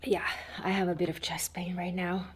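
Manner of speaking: whispering. Real, not acted. Chest pain, quiet aside.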